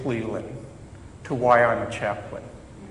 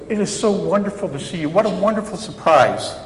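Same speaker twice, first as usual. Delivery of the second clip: gushing greeting; 6 semitones higher, faster than usual. Retold or imitated. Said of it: "I could have said something like".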